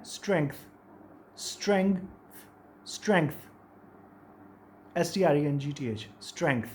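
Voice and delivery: स्ट्रेंथ ending on a nasal ng with a k; pronunciation demo. Shown as a demonstration. Teacher drilling students on saying स्ट्रेंथ. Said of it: "उसकी pronunciation क्या है?".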